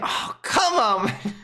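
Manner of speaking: high, slow whining wail; frustrated wail. Real, not acted. Card not drawn, cries out.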